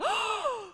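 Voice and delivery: high, horrified gasp; startled gasp. Retold or imitated. Woman startled by a reflection, gasping in fright.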